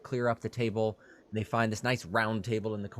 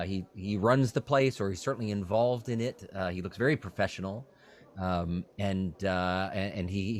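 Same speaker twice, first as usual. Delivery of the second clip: ordinary delivery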